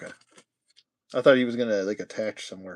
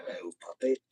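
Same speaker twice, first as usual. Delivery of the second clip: low, soft put-on voice; low puppet greeting; 5 semitones lower, 6 dB quieter, faster than usual. Host greeting a puppet, low soft voice.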